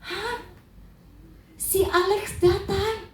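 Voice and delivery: high, drawn-out startled question; startled disbelief, pitched well up; shocked. Retold or imitated. Wife hears from her sister that Alex has come, shocked. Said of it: "Perempuan tuh begitu kaget".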